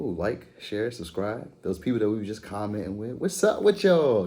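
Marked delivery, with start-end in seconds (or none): 3.1-4.3 loud, high, drawn-out hello; excited greeting. Real, not acted. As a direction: call out to the fans, stretched. Greeting viewers, calling out.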